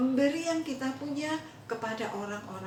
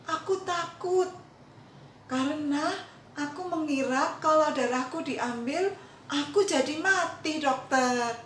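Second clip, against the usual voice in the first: put-on earnest frightened boy; 5 semitones higher, 5 dB louder than usual. Boy to doctor, confessing he feared dying, high and earnest. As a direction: plead it like a scared child.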